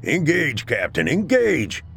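high, urgent battle yell. A narrator's reading. Sergeant orders his captain to fight, yelling. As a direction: shout the order over gunfire. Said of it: "he yelled".

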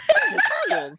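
squeaky, laughing goblin voice way up high; a Hoggle impression cracking up with laughter. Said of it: "every accent I do for every character ... is Hoggle".

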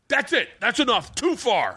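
high-pitched mock shout of protest; angry heckler. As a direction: stand up and object, imitating a heckler. Retold man stands up objecting.

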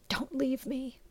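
soft unspoken plea; whispered plea. A narrator's reading. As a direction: barely breathe it, begging. Sasha's plea, heard as a whisper.

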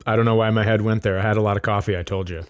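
ordinary delivery

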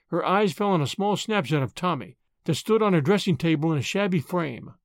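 ordinary delivery, nothing marked

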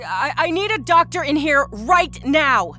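loud, high, urgent call for help; nurse's urgent bellow. A narrator's reading. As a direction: call out slowly and forcefully down a hallway. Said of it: "she bellowed out".

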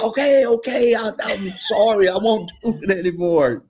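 high, meek put-on voice backing down; sheepish apology. Coach apologizing to his angry player, meek and high. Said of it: "I was like".

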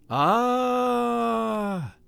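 long, high held wail; ghostly singing vowel; sung "ah". A narrator's reading. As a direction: sustain an eerie choral ah. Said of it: "sang the voices".

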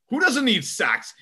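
high, drawn-out imitation of a fan; mock incredulous question. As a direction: ask it slowly as if it's obvious. Mocks fans switching sides on sacks.

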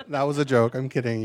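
ordinary talk, nothing marked

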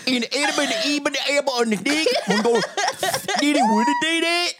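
gibberish Genesis. Pretend. Retelling Genesis in gibberish to the family, hammed-up. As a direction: rattle through a nonsense Genesis, laughing. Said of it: "Can you do Genesis one?".

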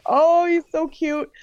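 high, drawn-out retold coo; adoring coo. New mother touching her baby for the first time, adoring.

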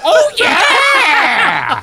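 high, loud drawn-out oh yeah; fake interest reaction.

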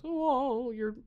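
high, sweet pretend cat voice; cat's voice. Cats comforting a crying guest, high and sweet.

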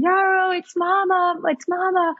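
high, sing-out motherly call; retold high-pitched cooing call; calling to baby. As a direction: call out to a newborn across the room. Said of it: "calling to her".

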